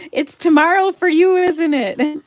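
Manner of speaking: high, loud delighted teasing; excited tease. Real, not acted. Host teasing Donna about time zones, high and loud. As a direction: squeal it at her friend, close to a laugh.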